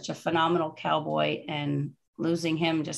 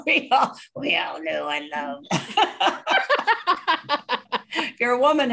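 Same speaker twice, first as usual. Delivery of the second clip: high laughing burst; 7 semitones higher, 3 dB louder than usual. Real, not acted. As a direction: laugh through it. Host laughing about voice.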